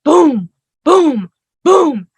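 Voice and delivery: loud, high mock pounding noise; imitated banging. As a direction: bang out the thuds like a fist on metal. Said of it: "all of a sudden I hear boom".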